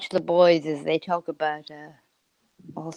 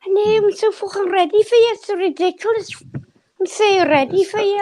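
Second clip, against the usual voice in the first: bad high Scouse accent; hammy Liverpool put-on, pitched up; 11 semitones higher than usual. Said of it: "That's a terrible Scouse accent".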